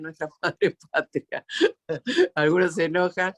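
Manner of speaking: real chuckle through the words; laughing. Real, not acted. Host teasing that some get angry about the motherland, laughing. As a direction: say it while laughing. Amused at her own provocation.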